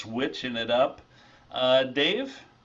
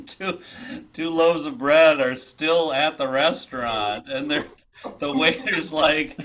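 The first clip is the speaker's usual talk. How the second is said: ordinary delivery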